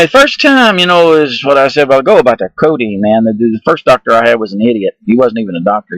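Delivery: ordinary talk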